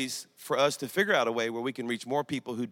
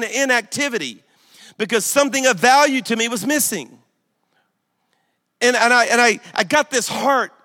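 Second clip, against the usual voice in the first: loud, higher, impassioned sermon delivery; full-force preaching; 7 semitones higher, 9 dB louder than usual. Acted. Pastor tells the congregation what losing his son felt like, loud and impassioned. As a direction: preach it with everything you have.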